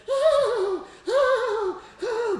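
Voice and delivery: high, loud mock moans of pleasure; hammed-up high-pitched sex moans; mock moaning. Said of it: "I could hear her going".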